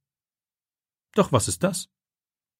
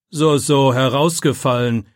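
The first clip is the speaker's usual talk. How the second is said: loud, scornful "So, so, herausgefallen"; scolding; 2 semitones higher, 6 dB louder, slower than usual. A narrator's reading. Teacher mocks Philipp's excuse in a loud scolding voice. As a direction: throw the boy's word back at him mockingly. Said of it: "schimpft Herr Wagner noch lauter".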